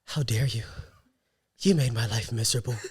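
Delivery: low, soft, wounded teacher; hammed-up heartbreak with long pauses; acted hurt reproach. Teacher expecting an apology from student, acted hurt reproach. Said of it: "I'm about to get in my acting bag".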